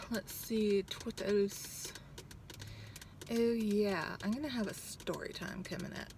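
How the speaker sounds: ordinary delivery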